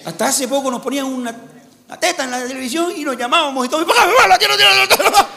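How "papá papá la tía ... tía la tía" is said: put-on high, loud child's squeal of "¡Papá, papá, la tía!"; a little boy yelling for dad at the TV; excited kid shouting. Kid squeals to dad at the TV, high and loud.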